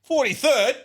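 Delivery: high, loud disbelieving cry; can't believe the number; shocked. Real, not acted. Friend reading a penis ranking, shocked shout.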